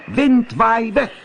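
high, loud, slow shout of the spell; little cat shouting the charm; loud call. A narrator's reading. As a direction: yell it to break the spell. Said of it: "heel hard roepen".

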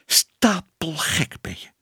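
slow, high, indignant exclamation; exasperated cry. A narrator's reading. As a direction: cry it out, stretching the words in disbelief. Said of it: "riep tante Moortje". (verontwaardigde, langgerekte uitroep)

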